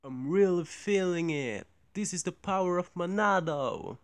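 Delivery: game hero voice. Retold or imitated. Game character quote impression.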